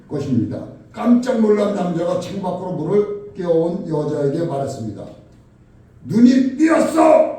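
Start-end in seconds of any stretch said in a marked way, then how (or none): 6.1-7.3 loud, high, drawn-out yell; angry shout. Retold or imitated. Startled man yells up at the woman who spilled water, angry shout. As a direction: shout it slowly in startled outrage. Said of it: "소리를 지른 겁니다".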